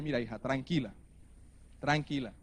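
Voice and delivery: soothing father. Retold or imitated. Father calming frightened daughter.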